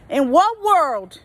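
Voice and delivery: slow, disbelieving question; incredulous. Real, not acted. Worker scoffing at an entitled customer, slow disbelief. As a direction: drag it out in disbelief.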